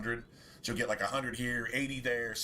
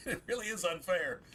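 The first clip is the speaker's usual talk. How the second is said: whiny laughing; 5 semitones higher than usual. Real, not acted. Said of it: "I know I sound like a whiny bitch".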